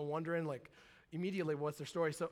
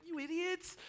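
exasperated; 11 semitones higher, 4 dB quieter than usual. Retold or imitated. Reaction to kid's crime.

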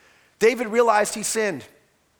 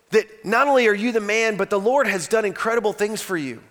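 usual speaking voice

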